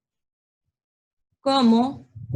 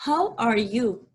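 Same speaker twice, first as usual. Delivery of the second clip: slow model question; slower than usual. Shown as a demonstration. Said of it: "Entonces tú utilizas aquí el how".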